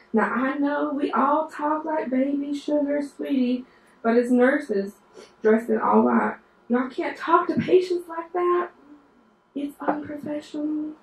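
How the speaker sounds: a hint of a starchy teacher correcting nurses; lecturing professor. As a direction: mimic a proper instructor scolding the class. Quoting Doctor Lowry lecturing the class.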